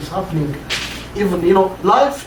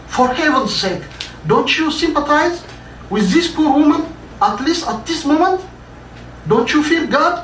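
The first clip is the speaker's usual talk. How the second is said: much higher, heated, reproachful outcry; furious plea; 6 semitones higher than usual. Acted. Charlotte confronting the heartless boss, furious high outcry. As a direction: shame him with outraged pleading. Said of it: "Charlotte was furious".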